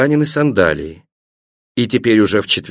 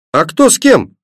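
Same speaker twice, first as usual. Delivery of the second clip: put-on high-pitched, mildly anxious child's question; faltering voice; 7 semitones higher than usual. A narrator's reading. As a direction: ask with a touch of worry, pitched high. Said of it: "дрогнувшим голосом спросила Светлана". (тревожный детский вопрос)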